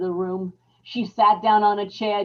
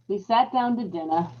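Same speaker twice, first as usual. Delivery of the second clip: ordinary talk, nothing marked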